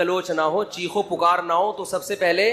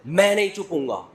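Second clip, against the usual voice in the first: mock yelled-back refusal; defiant, lightly acted comeback; defiant retort. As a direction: imitate a child answering back forcefully. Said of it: "اتنی زور سے کہہ رہے ہوتے ہیں کہ آگے سے جواب آتا ہے".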